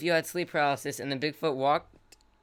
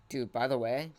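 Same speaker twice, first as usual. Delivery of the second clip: usual speaking voice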